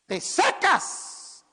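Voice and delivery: slow, high, loud command; drawn-out commanding voice; Jesús. Acted. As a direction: pronounce a sentence with authority. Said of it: "Voz de mando".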